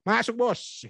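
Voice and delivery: high, chummy call to the boss; overly casual MC. Shown as a demonstration. MC wrongly waves the President in, high casual call. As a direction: call him in like a buddy. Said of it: "Nggak mungkin kan".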